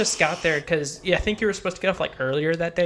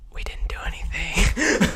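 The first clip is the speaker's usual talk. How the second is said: whispered, slow, innocent; whispered protest; 4 semitones higher, slower than usual. Retold or imitated. Guest whispering their innocence to the couple, slow. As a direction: hush it like a guilty kid.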